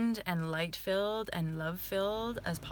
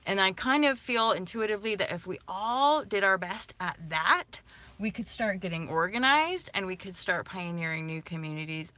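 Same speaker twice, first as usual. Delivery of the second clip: ordinary talk, nothing marked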